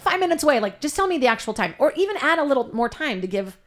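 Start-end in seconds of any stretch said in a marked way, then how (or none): 0.0-0.6 high, breezy fake reassurance; mocking liar voice. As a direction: sell the lie lightly. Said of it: "I'm like".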